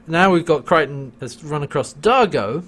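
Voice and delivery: normal talking voice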